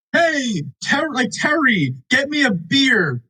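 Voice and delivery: high, slow mock call to the robot; lightly voiced guy calling for a beer. Man calling his pterodactyl to bring a beer, mock call. Acted quote of Cade calling.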